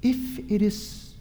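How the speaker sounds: soft, hesitant touch of doubt; halting and quiet, testing who is there; doubtful. Acted. Peter calling to Jesus on the water, mildly doubtful. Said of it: "can you sense the doubt?".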